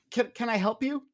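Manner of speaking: a hint of a polite young clerk; girl at the table. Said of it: "the girl at the table speaks up and goes".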